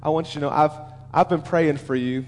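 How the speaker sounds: low, softened, confiding voice; tender reassurance. Acted. Jesus assuring Peter of his prayers, tenderly. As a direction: speak low and soft, as if privately to a friend. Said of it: "Jesus looks at him and says".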